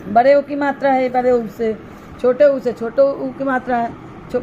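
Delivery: ordinary talk, nothing marked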